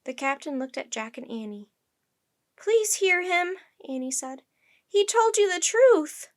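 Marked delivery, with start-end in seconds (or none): 2.6-3.6 high, loud, slow plea; earnest pleading. A narrator's reading. Annie begs the captain for mercy, pleading. 4.9-6.3 high, loud, slow insistence; earnest pleading. A narrator's reading. Annie appeals to the captain, pleading slowly.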